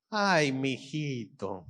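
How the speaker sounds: slow, soft motherly sigh of words; tender mother. Shown as a demonstration. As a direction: draw it out softly over your little boy. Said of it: "cuando las mamás". (mamá tierna, lento y suave)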